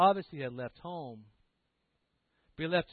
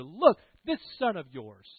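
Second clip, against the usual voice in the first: loud, pointed accusation; disrespectful disowning; 7 dB louder than usual. Acted. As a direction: spurn the brother with contempt. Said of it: "he disrespects them".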